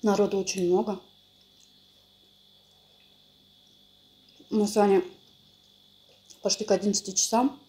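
eating between words; talking while chewing. Real, not acted. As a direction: mouth full of cake. Seen 0: Woman bites cake at 1.1–3.3s, keeps chewing while talking.

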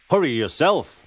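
调皮的回嘴; cheeky retort. A narrator's reading. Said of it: "replied Thomas".

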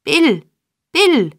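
high, loud desperate calls; calling out. A narrator's reading. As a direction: call after him as he runs. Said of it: "rief der Jude ihm nacheilend".

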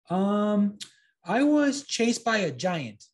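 put-on high, hesitant boy fibbing; nervous little liar; lying puppet boy. A narrator's reading. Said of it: "he lied".

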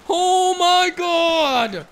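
long, drawn-out high shout; exasperated yell. Real, not acted. Gamer getting mad at the game, exasperated yell. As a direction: bellow it slowly in outrage.

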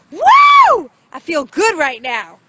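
very high, loud whoop then shouted cheer; jubilant shout. Real, not acted. Angler celebrating a beautiful bass, jubilant shout. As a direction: yell out with joy.

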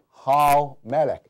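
很慢地读how melech，重读how; 拉长示范how melech; slow demo. Shown as a demonstration. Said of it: "假如你要表达the king". (慢速示范发音)

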